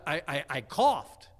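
loud, slow answer with false starts; stammered loud reply. Retold or imitated. Husband explains the noise to wife upstairs, stammered loud reply.

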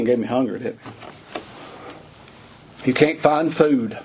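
normal talking voice

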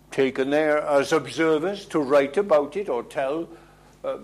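ordinary talk, nothing marked

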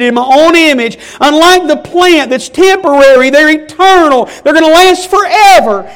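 loud, high, impassioned preaching; preaching at full force. Acted. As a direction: build the plea to a shouting peak. Preaching climax.

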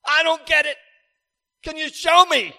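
high, loud pleading cry to the Lord; crying out to heaven. Retold or imitated. Preacher retelling his prayer, crying out loud to the Lord. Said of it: "I've cried out to heaven and said".

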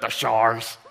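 "The showers" in a broad Northern Ireland accent. Shown as a demonstration. Preacher relishing the word in Northern Ireland accent. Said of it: "great to say in a Northern Ireland accent".

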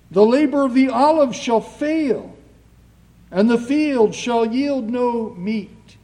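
ringing pulpit reading, phrase by phrase; scripture reading. Acted. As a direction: proclaim the verse to the congregation. Reads scripture aloud in sermon.

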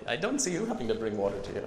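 quick imitated Indian accent. Indian missionary challenges complainers about fetching water, Indian accent. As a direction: reason briskly in a put-on Indian accent. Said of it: "I'll stop doing the Indian accent".

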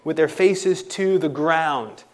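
loud, emphatic sermon delivery; full-force preaching. Acted. Preacher stressing to the congregation, loud and emphatic. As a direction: drive home how awestruck they were.

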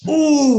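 high, loud ooh of wonder; a burst of amazed ooh; excited ooh. Retold or imitated. Paul marvelling at his past.

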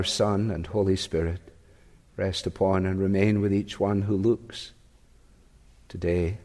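low, solemn pastoral prayer; closing prayer. Real, not acted.

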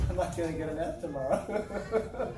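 laughing. Retold or imitated. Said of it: "and I started laughing".

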